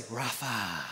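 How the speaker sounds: breathed out low and soft on the exhale, "Rapha"; exhaled word. Shown as a demonstration. Pastor leads the church in exhaling "Rapha" together. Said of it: "as we exhale, let's say".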